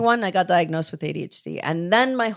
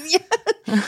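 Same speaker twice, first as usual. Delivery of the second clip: laughing yes; 9 semitones higher than usual. Real, not acted. Admits boredom as a new mother.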